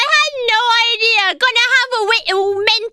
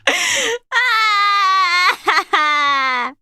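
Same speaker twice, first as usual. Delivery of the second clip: long wheezing laugh; drawn-out real cackle; helpless laughter; 2 semitones lower than usual. Real, not acted. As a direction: laugh until the breath runs out. Laughing hard after cheeky joke.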